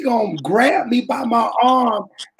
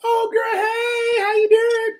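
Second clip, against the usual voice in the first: high falsetto fake hello; fake cheerful greeting; 12 semitones higher than usual. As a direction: chirp it high to cover the bruise. Imitating bruised woman greeting.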